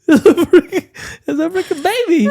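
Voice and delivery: high, slow words through laughter; laughing. Real, not acted. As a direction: crack up at the tiny tree. Laughing at tiny tree.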